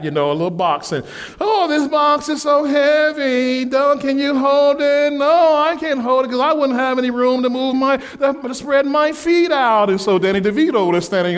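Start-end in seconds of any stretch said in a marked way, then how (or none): 1.4-5.1 complaining whine. Retold or imitated. Wendy whining at Doug about the heavy box. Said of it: "they begin to complain some more". 5.1-9.9 whining refusal. Retold or imitated. Doug whining back at his wife about the box, whiny. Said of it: "they begin to complain some more".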